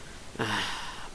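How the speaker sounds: soft exasperated sigh; quiet, voiceless exhale. Acted. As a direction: let out an airy sigh. Bon Bon exasperated by the listener.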